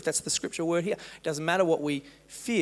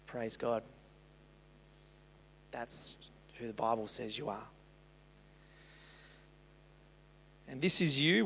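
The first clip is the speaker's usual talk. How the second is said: soft, tender address; 2 semitones lower, 3 dB quieter than usual. Acted. Pastor speaking tenderly to a hurting listener, softly. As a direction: speak gently to someone hurting.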